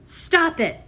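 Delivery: high, loud command; shouted. A narrator's reading. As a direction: bark the order. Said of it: "shouted Roscuro".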